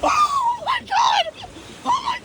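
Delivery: high panicked shriek; screaming oh my God. Real, not acted. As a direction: shriek in sudden terror. Tent hit by blast.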